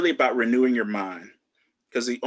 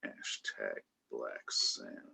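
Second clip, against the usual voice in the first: announcer-style tag line, low and drawn out; deep slow tagline; 13 dB quieter, much slower than usual. Acted. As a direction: drop the voice low and slow for the catchphrase.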